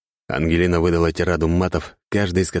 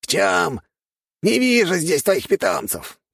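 high, sneering squeaky voice; mocking rat king; 13 semitones higher than usual. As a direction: taunt him from above. Rat king mocks hero.

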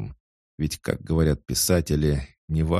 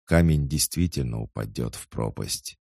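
ordinary delivery, nothing marked

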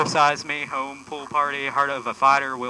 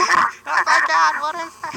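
shocked; 6 dB louder than usual. Real, not acted. Shocked at sudden loud music.

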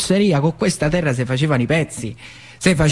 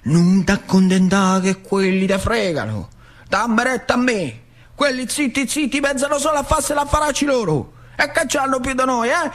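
much higher rousing uncle against the bosses; zio Peppe; 10 semitones higher than usual. Acted. Uncle whispering rebellion in the boy's ear, high rousing voice. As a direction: stir him up, voice pitched high and urgent. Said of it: "mi diceva".

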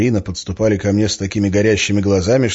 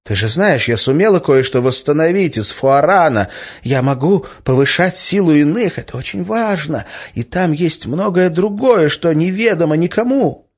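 higher-pitched, coaxing woman's voice; insinuating; 8 semitones higher, 3 dB louder than usual. A narrator's reading. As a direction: coax him, pitching it up. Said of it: "Её голос стал вкрадчивым". (вкрадчиво)

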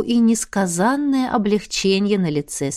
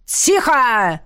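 commanding bark; 3 semitones higher than usual. Acted. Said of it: "рявкнул мой великолепный фантом".

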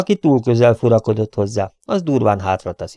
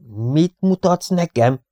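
slow, higher-pitched astonished question; puzzled wonder; 6 semitones higher, slower than usual. A narrator's reading. Rover asks Robin what he is showing, wondering and drawn out. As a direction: stretch it out in wonder, pitch lifted. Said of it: "kérdezte csodálkozva Rover".

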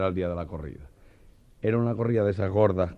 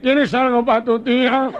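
much higher, louder, slow laughing squeal; panicked squeak; 10 dB louder, slower than usual. Retold or imitated. The Meloja asking Joselito, a high laughing squeal. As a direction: squeal the punchline.

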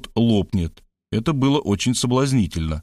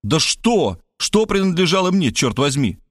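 exasperated outburst, pitch jumping up; understated exasperated yell; mild narrated shout; 6 semitones higher than usual. A narrator's reading. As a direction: cry out baffled, without real volume. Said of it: "Я вышел из ступора и закричал".

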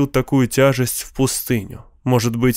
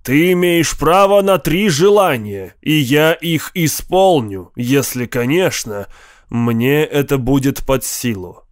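booming genie. Acted. Ifrit grants three wishes. (громкий игривый голос джинна)

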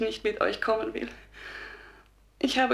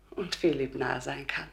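softer whispered plea; 2 semitones lower, 4 dB quieter than usual. Acted. Dead Harriet whispers to the archangels, pleading.

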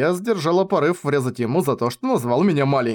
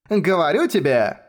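put-on drawn-out, higher old lady's retort; snapping back; 4 semitones higher than usual. A narrator's reading. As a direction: snap back as a quarrelsome old woman. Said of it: "огрызнулась она".

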